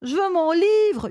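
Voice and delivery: high, drawn-out demand; child's howl. A narrator's reading. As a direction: wail it like a furious kid. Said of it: "hurla Marcel".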